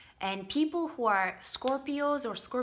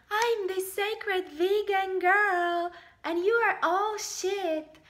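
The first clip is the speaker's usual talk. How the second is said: high, drawn-out mocking sing-song; hammed-up spiritual girl, much higher and slower; sacred vegan girl; 9 semitones higher, slower than usual. Mocking people with egos in the sky, sacred vegan girl. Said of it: "with your spirituality up here".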